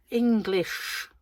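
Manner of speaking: a light take on a hammed-up posh English spy; Austin Powers English. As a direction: say English like a mock swinging sixties Brit. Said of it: "as Austin Powers would say".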